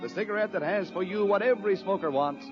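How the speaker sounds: normal talking voice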